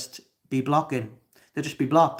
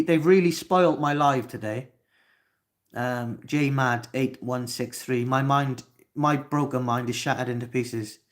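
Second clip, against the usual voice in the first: ordinary talk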